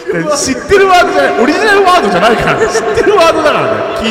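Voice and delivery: high, loud laughing protest; excited protest. Real, not acted. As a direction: push back loudly, half laughing. 笑いながら言い返す. (高い声で大きく笑いながら反論)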